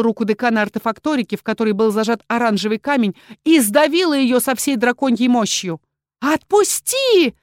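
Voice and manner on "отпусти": high, slow, drawn-out scream; frantic scream. A narrator's reading. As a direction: scream it in agony. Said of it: "истошно заорала Валерита".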